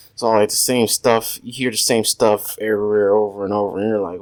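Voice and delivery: normal talking voice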